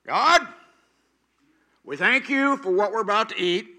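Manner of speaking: loud, high, defiant prayer; proud patriarch's grace. Retold or imitated. James Stewart's patriarch says a proud, loud grace. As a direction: thank God grudgingly, boasting of the work. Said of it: "it goes like this".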